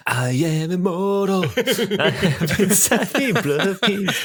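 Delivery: high, slow, hammy heroic declaration; grandiose boast. Pretend. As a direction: proclaim it like an immortal hero, drawn out. Imagined boastful duelist voices.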